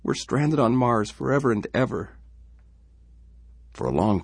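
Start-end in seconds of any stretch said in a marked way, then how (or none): 0.0-2.1 put-on much higher tearful girl; weeping. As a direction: wail it through tears. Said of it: "Laura wept".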